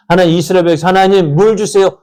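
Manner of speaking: high, loud pleading people; mock plea. Preacher voicing the Israelites begging God for water. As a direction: beg like the Israelites.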